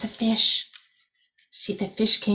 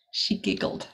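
whispered, hushed; soft giggly whisper; 7 semitones lower, 6 dB quieter than usual. A narrator's reading. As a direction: breathe it like a tiny giggle. Reading a children's story aloud.

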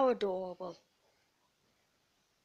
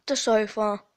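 the sofa, called out much louder; loud punchline; 9 dB louder than usual. Pretend. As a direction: land it big like a punchline. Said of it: "back in its natural habitat".

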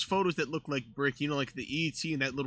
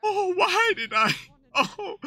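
mock lament; 5 dB louder, slower than usual. Lamenting siding with Vivek.

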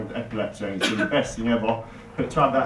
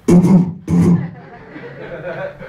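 audience laughing loudly; big laugh from the crowd; crowd laughter; 6 semitones higher, 9 dB louder than usual. Real, not acted. Stand-up crowd laughs at joke.

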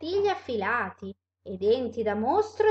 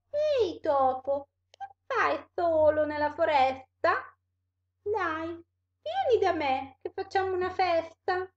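higher-pitched animal character voice; wily animal inviting a mouse; 7 semitones higher than usual. Acted. Animal invites the mouse to a party, coaxing. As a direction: coax in a raised storybook voice.

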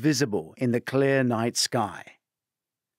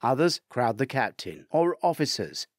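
ordinary delivery

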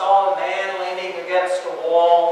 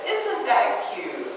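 high, slow cooing mother; a mother cooing over her baby; cooing mom; 13 semitones higher, slower than usual. Acted. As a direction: coo it like a doting mom.

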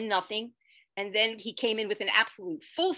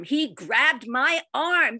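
higher, louder and slow; stretched, amazed emphasis; emphatic; 6 semitones higher, 8 dB louder, much slower than usual. Real, not acted. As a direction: relive the moment with awe. Emphatic repeat of moment.

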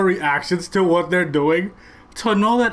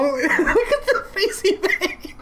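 laughing; 7 semitones higher, slower than usual. Real, not acted. Laughing at friend's reaction on video.

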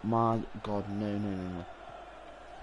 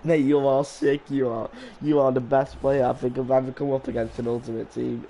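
loud mock admiration; booming ironic compliments; sarcastic praise; 8 dB louder than usual. Real, not acted. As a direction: hype up the opponent sarcastically. Mock-praising opponent loudly.

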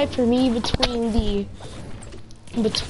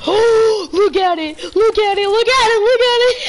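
gasp, then shout it higher and louder each time; can't believe your eyes, yelling at the screen; thrilled rising shouts; excited shouting; 10 semitones higher, 7 dB louder than usual. Real, not acted. Gun returns in game, gasping.

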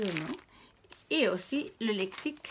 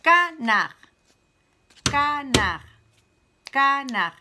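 Canard scandé haut et très fort, ca-nard en deux coups; syllabes frappées; 6 semitones higher, 10 dB louder, slower than usual. Shown as a demonstration. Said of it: "frapper deux fois dans la main". (maîtresse qui scande Canard en deux frappes)

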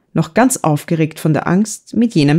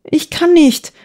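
wailing; 11 semitones higher, slower than usual. A narrator's reading. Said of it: "jammerte Eva".

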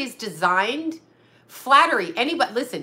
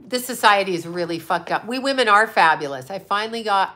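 ordinary talk, nothing marked

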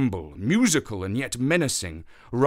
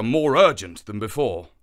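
ordinary delivery, nothing marked

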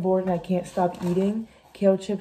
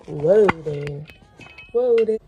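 loud startled whoa; 3 semitones lower, 7 dB louder, much slower than usual. Real, not acted. Camera wobbling, calls out to it.

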